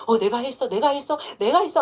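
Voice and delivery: mock boasting that builds to a loud claim; boastful claim, building. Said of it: "하고 내가 영광을 막 취해버려".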